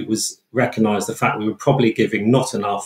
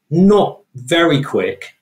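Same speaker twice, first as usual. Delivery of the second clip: "not very quick" slowed down, each word leaned on, louder; stress on not very quick; 6 semitones higher, 4 dB louder, much slower than usual. Real, not acted. Expert stresses to host "not very quick" with slow, leaning emphasis. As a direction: drag out "not very quick" to land the point.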